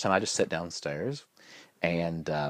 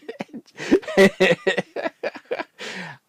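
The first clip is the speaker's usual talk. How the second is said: high, loud cackling laugh; helpless laughter; 13 semitones higher, 8 dB louder than usual. Real, not acted. Husband recalling yelling at wife, laughing hard. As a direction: crack up at your own story. Cracks up at his own story.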